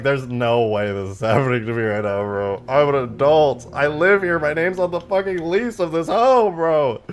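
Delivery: loud, exasperated outburst; indignant protest. Retold or imitated. Streamer venting at the salesman, loud and indignant. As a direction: protest that you are an adult.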